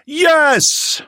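high, loud full-throated yell; yelled at the top of lungs. A narrator's reading. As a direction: shout it in unison. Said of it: "yelled at the top of their lungs".

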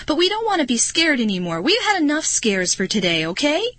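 fast, high-pitched protest; shrill blurting. A narrator's reading. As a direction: blurt it out, pleading to be left alone. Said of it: "Marty blurted out shrilly".

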